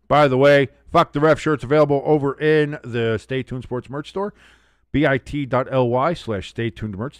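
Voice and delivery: normal talking voice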